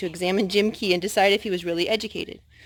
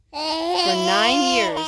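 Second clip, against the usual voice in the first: drawn out, much slower and higher; slow storybook emphasis; 8 semitones higher, 4 dB louder, much slower than usual. A narrator's reading. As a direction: stretch it out for the child.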